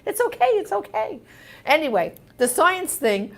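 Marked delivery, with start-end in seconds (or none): 0.0-1.2 high, sweet pet voice soothing its owner; dogs comforting mommy. Pretend. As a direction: squeak it like a little dog consoling. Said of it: "my dogs got on my lap as if to say".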